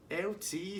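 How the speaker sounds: high, tentative imitated call; Tasty Dave. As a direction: try the nickname softly up high. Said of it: "And he's like".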